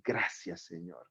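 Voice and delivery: muy suave, casi susurrado; da gracias en voz baja de rodillas; susurro de gratitud. Retold or imitated. Arrodillado dando gracias. (oración susurrada de rodillas al Señor)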